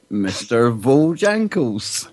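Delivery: slow, savoured name announcement; drawn-out reveal. Pretend. Co-host awarding best chat room name, drawn-out reveal. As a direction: stretch out the name like a prize winner.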